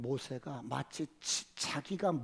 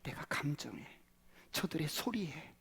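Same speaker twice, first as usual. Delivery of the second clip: hushed whispered regret; regretful whisper; 7 dB quieter than usual. Acted. Moses realizing his mistake, whispering. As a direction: breathe out the realization softly.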